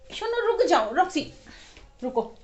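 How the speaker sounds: loud, high call to the dog to stop. Real, not acted. Mother suddenly calls out to stop her dog Roxy, loud and high. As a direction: shout after Roxy to halt, real and sudden.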